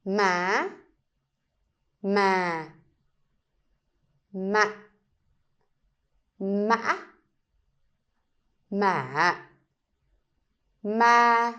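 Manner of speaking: ma said in each of the six tones in turn; six Vietnamese tones. Shown as a demonstration. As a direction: run ma through all six tones. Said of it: "read all of the words together".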